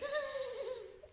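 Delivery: high-pitched squealed sob imitation; imitated crying noise. Shown as a demonstration. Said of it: "And then you're like".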